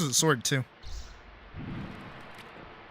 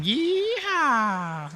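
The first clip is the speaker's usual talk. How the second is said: high, whooping cowboy cry; excited yeehaw; 10 semitones higher than usual. Retold or imitated. Meme reference punchline.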